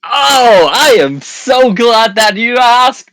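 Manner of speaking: loud, high and slow, big sell; overexcited announcer. Acted. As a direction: gush like a TV host pitching. Hammy promo reply to setup.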